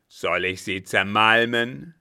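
loud, crushing threat; thundering. Acted. Captain thunders a threat to crush the German. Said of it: "donnerte er".

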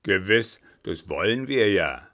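loud, forceful villain; loud overbearing brother; domineering brother. A narrator's reading. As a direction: speak up hard, brooking no argument. Brother coldly threatens captive sister.

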